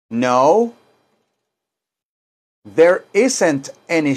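slow, deliberate model sentences for the class; slow teaching model. Shown as a demonstration. Teacher to Spanish-speaking class, slow clear model sentences. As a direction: spell it out slowly so students can copy. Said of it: "teacher models sentences for repetition".

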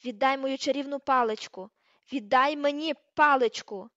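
loud, furious demand; enraged shout, stretched at the end; frenzied demand. A narrator's reading. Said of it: "Вона просто знавісніла".

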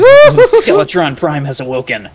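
loud, slow dramatic announcer; booming game-event proclamation; boss announcement. Pretend. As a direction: proclaim it slowly and loudly. Announces the boss's arrival.

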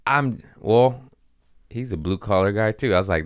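usual speaking voice

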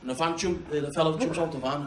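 usual speaking voice

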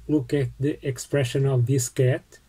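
usual speaking voice

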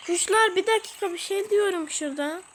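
loud, fast call to hush. Real, not acted. Hushing the crowd sounds.

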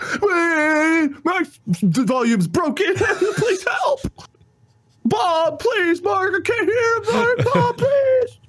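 high-pitched whiny mock pleading; whining fake fans. Co-host mimicking fake fans begging for help, high whining. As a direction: wail it out like a hapless complaining viewer. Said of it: "they typed it in that accent".

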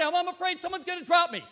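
imitating a scared believer; mock fearful worry. Preacher voicing a worried churchgoer, whiny fear.

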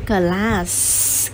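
"class" slowed, with a long hissed S; final S sounded. Shown as a demonstration. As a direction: say "class" and hold the S at the end. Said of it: "cũng như khi đọc nhớ có S". (đọc "class" chậm, ngân rõ âm S cuối)

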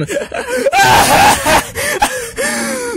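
high, wild villain laugh; evil cackle. Acted. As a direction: cackle like a mad ringmaster. Villain laughs mid-song.

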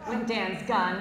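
normal talking voice

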